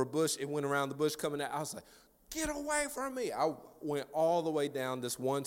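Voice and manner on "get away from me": hammed-up fleeing cry; mock panic. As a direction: plead in a comic, squeaky panic. Acts out fleeing the dog.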